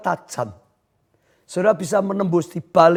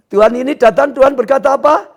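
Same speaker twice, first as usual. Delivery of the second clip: ordinary talk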